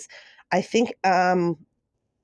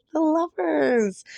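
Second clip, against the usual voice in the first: higher and slow, savouring the card name; thrilled reveal; 9 semitones higher, slower than usual. Real, not acted. Tarot reader revealing the Lovers to Virgo, thrilled and slow. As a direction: announce it in awe, drawing it out.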